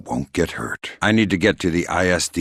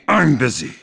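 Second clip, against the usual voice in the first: irritated snap; 8 semitones higher, 4 dB louder than usual. Acted. Repeated irritated phone brush-off.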